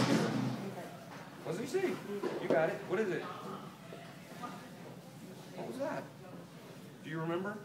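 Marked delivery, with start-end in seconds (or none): none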